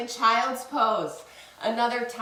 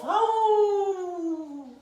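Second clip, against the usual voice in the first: long high howl gliding down; drawn-out playful wolf "awoo"; 9 semitones higher, 4 dB louder than usual. Shown as a demonstration. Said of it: "Let's hear a howl now for the wolf".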